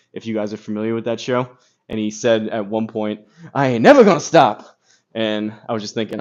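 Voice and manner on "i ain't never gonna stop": loud, punchy catchphrase quote, stress on never; comic catchphrase. Retold or imitated. Quoting the Kill Tony line Ben dropped, loud punchy catchphrase. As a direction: belt it like a stand-up's signature line.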